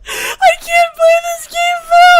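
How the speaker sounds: high, loud, laughing; crying with laughter. Real, not acted. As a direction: shout through helpless laughter. Helpless laughter, shouting.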